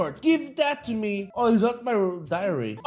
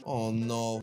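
quiet dread; 12 semitones lower, 8 dB quieter than usual. Acted.

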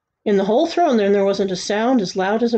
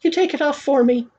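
put-on higher child's voice; young boy; 6 semitones higher than usual. A narrator's reading. Boy asking the executioner's help, higher young voice. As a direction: hand it over in a boy's raised pitch. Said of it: "said Bartholomew".

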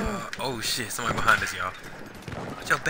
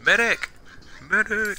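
loud high call for a medic; man down shout; 11 semitones higher, 4 dB louder, slower than usual. Real, not acted. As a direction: yell for help, hit and down. Downed, calling for medic.

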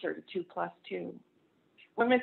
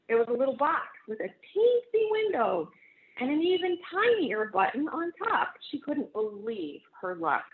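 put-on lively, animated read-aloud for children; storybook reading; 3 semitones higher, 4 dB louder than usual. A narrator's reading. Pastor reads picture book to congregation, animated storytelling. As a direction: read it to a room of kids, bright and expressive.

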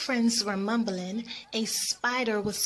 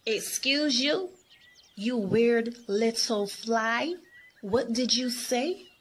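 offended attitude; 2 semitones higher than usual. Acted. Miami offended by Niagara's remark, sassy outburst. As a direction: snap back with attitude, questions lifting. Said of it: "so with an attitude, Miami shouted".

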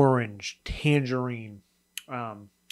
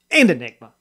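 dramatic reveal; 9 dB louder, faster than usual. Real, not acted. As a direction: build suspense, then land the name like a show host. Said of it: "I don't know why I said it like that".

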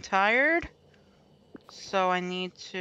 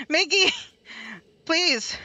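high, loud pleading; exasperated plea; 8 semitones higher, 3 dB louder, slower than usual. Real, not acted. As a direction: plead loudly for them to move. Pleading with game character to move.

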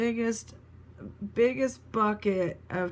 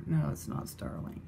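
lower, whispered self-correction; 5 dB quieter than usual. Real, not acted. Checking the stamp, whispering a low correction.

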